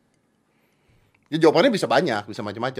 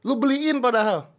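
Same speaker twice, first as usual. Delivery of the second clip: high, louder excited protest; excited insistence; 5 dB louder than usual. Real, not acted. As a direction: insist loudly, pitched way up. Host stresses the bike gift.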